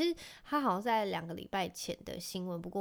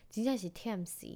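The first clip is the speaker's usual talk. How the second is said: ordinary delivery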